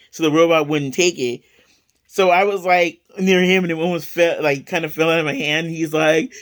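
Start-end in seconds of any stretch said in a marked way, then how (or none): none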